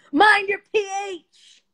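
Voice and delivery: sing-song sass on "Mind your P H"; sassy high voice. Shown as a demonstration. To viewers, a sassy put-on high voice for "Mind your P H".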